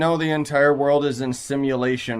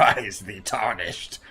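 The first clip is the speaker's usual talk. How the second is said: epic narrator; 5 semitones lower, much slower than usual. Retold or imitated. Mocking a game's epic opener.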